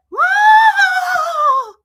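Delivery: long, very high, loud whoa; ghostly wail. Retold or imitated. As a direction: imitate a scream like a haunting ghost. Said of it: "She sounded like a ghost".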